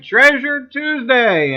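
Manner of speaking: Treasure Tuesday drawn out slowly and punched loud; slow announcer-style repeat of Treasure Tuesday; emphatic repeat. Acted. Host hyping the day to stream viewers, slow and emphatic.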